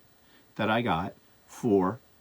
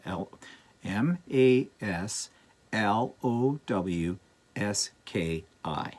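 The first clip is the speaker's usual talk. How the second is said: slow letter-by-letter spelling; slower than usual. Shown as a demonstration. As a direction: spell each letter slowly and clearly. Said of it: "I'm not sure if I'm saying that correctly".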